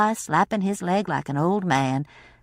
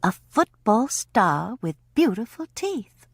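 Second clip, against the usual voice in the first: ordinary delivery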